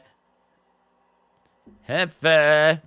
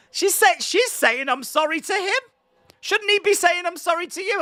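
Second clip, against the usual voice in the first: much higher, exasperated disbelief; incredulous rant; 9 semitones higher than usual. Real, not acted. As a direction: rant that it makes no sense. Outraged at the wife apologizing.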